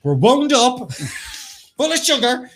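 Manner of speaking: high, loud mock sugar rush; mock hyper. Hosts joking about their energy, high and loud mock hyper. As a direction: ham up being wound up on sugar.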